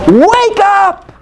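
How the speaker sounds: high-pitched preacher's call to wake up; ringing, drawn-out exhortation; rousing cry. Real, not acted. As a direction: cry it out to rouse the whole congregation. Preacher calls out to the church.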